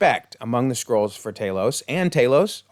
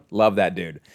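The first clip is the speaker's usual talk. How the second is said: ordinary talk, nothing marked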